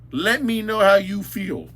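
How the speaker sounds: usual speaking voice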